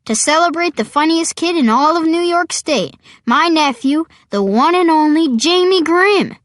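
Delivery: high, ringing party proclamation; proud announcement. Acted. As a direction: announce the guest of honour loudly.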